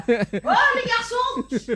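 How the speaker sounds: slow, high mock call with a laugh; drawn-out playful shout to the boys. Pretending to call her sons in from the room, loud and laughing.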